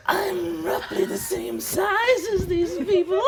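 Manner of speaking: high, slow little creature voice; Baby's voice. Acted. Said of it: "you see Baby says".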